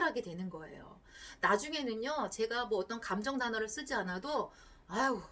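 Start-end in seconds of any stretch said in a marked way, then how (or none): 4.9-5.2 tender sympathy trailing into a hush; empathetic husband. Retold or imitated. Husband reading his wife's feelings, soft and tender. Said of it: "라고".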